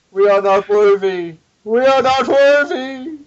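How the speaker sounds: high, loud mock-reverent chant, rising; mock worship. Host bows down to his co-host's awesomeness, mock worship.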